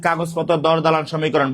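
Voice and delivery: normal talking voice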